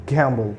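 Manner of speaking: "gamble" as GYAM-bul; shown pronunciation. Shown as a demonstration. Teacher modelling the word, "gamble" as gyam-bul. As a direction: glide from g into the vowel of "gamble". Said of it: "it would be pronounced as".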